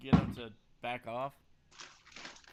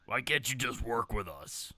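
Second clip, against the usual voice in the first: put-on New York accent; 5 dB louder than usual. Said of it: "Bebop Rocksteady sound".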